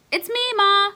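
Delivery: high, loud call toward the kitchen; yelling to mom. Acted. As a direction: call it out across the house. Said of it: "I yelled towards the kitchen".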